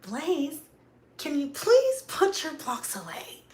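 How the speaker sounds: high, sugary mock-polite plea; talking sweet. Mom asking Blaze to tidy his blocks, sweet pleading. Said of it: "talking sweet don't work".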